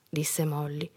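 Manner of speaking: plain reading voice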